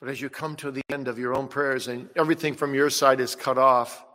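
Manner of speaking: usual speaking voice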